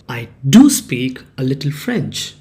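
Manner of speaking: emphatic "do" before "speak"; stress on DO. Shown as a demonstration. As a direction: lean hard on "do" to make the statement bold. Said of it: "You stress that part of the sentence".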